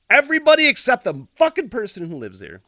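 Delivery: loud, high outburst of frustration; exasperated rant. Real, not acted. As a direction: hit it hard, fed up. Ranting about absent car owner.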